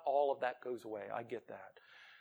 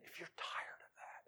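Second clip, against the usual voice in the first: very soft whisper; 15 dB quieter than usual. Acted. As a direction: hush it close to the mic. Hushed lead-in to invitation.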